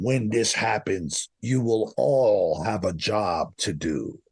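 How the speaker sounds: low, slow mock robot voice; a hint of an otherworldly robotic announcer. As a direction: intone it like a flat machine speaking in your head. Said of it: "a robotic voice".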